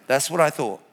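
normal talking voice

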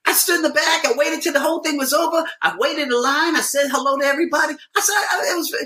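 high-pitched, rushing self-defense; retold outraged denial, pitch way up; indignant protest. Comedian defending himself to his agent, indignant.

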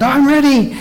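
high-pitched, overeager declaration; eager Peter. Acted. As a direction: blurt it out with impulsive confidence. Acting out Peter's rash eagerness.

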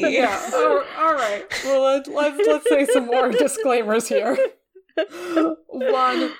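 high giggly voice, laughing; nervous laughter breaking through. Real, not acted. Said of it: "apologize for all my nervous laughter".

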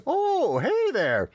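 high-pitched, drawn-out mock hello; hermit pony's greeting. Old hermit pony popping out of his shack, high hammed-up hail. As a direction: call out a greeting in a put-on high voice. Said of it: "he comes out and says".

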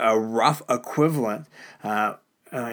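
ordinary delivery

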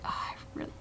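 hushed whispered thought. Retold or imitated. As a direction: whisper it like a secret. Quoting own private thought.

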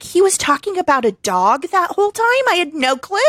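high-pitched incredulous question; amazed realisation. Retold or imitated. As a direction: act out dawning disbelief. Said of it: "and I'm like".

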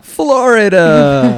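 slow, high, laughing; drawn-out Florida with a laugh; mock dramatic reveal. Mock reveal of vacation spot.

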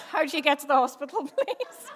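breaking into laughter, pitch climbing; quick, high, laughing through the question. Real, not acted. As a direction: laugh your way up to the last word. Joking recital of phrases learned.

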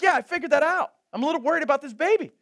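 high, loud, fast fretting; mock worried. Pastor fretting about the baby, mock worried and fast. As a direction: fret about the baby, half joking.

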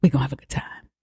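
softly whispered reassurance to herself. Pretend. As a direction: whisper it like a secret. Hushed aside after pep talk.

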